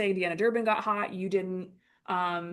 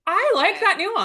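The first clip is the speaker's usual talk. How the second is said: very high, loud, drawn-out exclamation; over-the-top gleeful reaction; enthusiastic approval; 12 semitones higher, 12 dB louder than usual. Retold or imitated. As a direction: belt out your delight. Retelling own delighted reaction.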